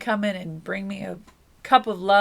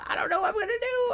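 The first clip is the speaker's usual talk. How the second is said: squeaky hurried dithering, higher; mock fretting, high and fast; 12 semitones higher, much faster than usual. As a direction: play the flustered querent, pitched up and rushing. Mock indecisive voicing of querent.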